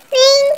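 high loud voiced ring like a phone; mock phone ring. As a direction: call out the ring like a telephone.

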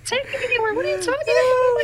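mock girlfriend voice. Girlfriend voiced, much higher.

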